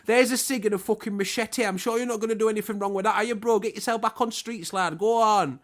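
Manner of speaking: hammed-up cheery lad outside the prison gate; mock matey ex-con greeting a released prisoner; prison bloke.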